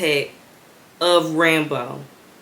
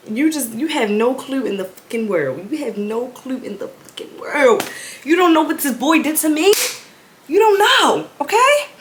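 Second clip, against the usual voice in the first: exasperated rant; 5 semitones higher than usual. Retold or imitated. Daughter ranting at her mother in her head, exasperated. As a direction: vent it, rising to a cry.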